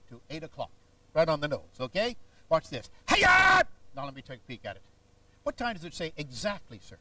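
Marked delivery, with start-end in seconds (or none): 3.1-3.6 sharp high martial arts yell; karate shout. Acted. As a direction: snap it out like a karate chop.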